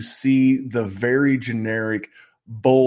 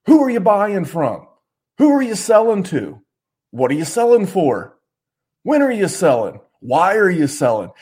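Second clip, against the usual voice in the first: higher, louder, hammering each question; fired-up angry questions; 6 semitones higher, 4 dB louder than usual. Real, not acted. Podcast host ranting at bad fantasy advice, loud and angry. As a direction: fire the questions at them like an angry coach. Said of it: "But I'm fired up".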